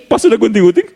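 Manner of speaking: high, puzzled question through laughter; surprised and amused at the short fur; laughing. Real, not acted. Laughing at own dog haircut. (bingung lucu melihat bulu anjing cepat habis, sambil tertawa)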